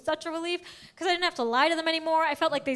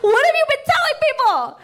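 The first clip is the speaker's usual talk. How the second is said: very high, loud shocked shriek; 14 semitones higher, 5 dB louder than usual. Retold or imitated. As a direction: shriek in disbelief. Shocked reaction to mom.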